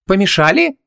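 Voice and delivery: high, rising question in a woman's voice; surprised. A narrator's reading. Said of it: "удивилась Ванесса".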